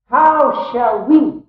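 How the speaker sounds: slow, high and loud, landing hard on WE; emphatic, very slow build onto WE; stress on WE. Shown as a demonstration. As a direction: draw out "How shall we", punching WE. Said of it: "emphasize that".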